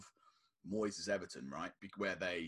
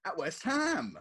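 disbelief; 5 semitones higher, much slower than usual. Real, not acted. Shocked at West Ham result.

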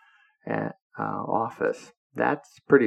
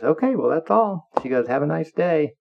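higher, louder send-off; retold breezy dismissal; 7 semitones higher, 4 dB louder than usual. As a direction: act out a boss wrapping things up. Secretary dismisses him after scolding.

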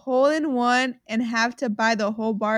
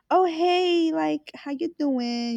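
higher, drawn-out flirty hello; flirty greeting; 5 semitones higher, much slower than usual. Retold or imitated. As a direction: greet a crush playfully. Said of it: "she'd be like".

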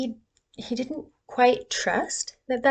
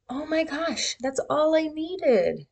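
relieved delight; 7 semitones higher than usual. Retold or imitated. Relief after partner listened.

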